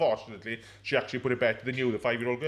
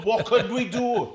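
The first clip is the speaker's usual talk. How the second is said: higher, drawn-out mock alarm; mock panic; 6 semitones higher, 3 dB louder, slower than usual. Teasing a teammate over his dehydration, mock-panicked. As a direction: wail it in exaggerated concern.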